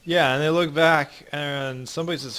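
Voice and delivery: usual speaking voice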